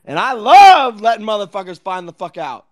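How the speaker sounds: loud gloat. Real, not acted. Gloating threat.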